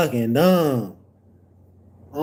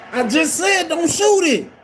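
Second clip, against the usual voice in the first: high, exasperated yell; outraged fan shout; screaming; 13 semitones higher than usual. Real, not acted. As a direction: yell it at a player who ignored you. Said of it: "I'm screaming at him".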